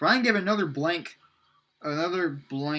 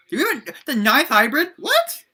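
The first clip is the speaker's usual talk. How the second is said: loud, high disbelief; startled outburst; 9 semitones higher, 8 dB louder than usual. Real, not acted. Reader reacts to a ninth hybrid appearing, startled. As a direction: jump up in pitch on a baffled question.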